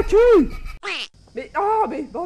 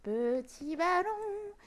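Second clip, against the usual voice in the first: soft coaxing whisper; 8 dB quieter, slower than usual. Pretend. Coaxing the balloon softly.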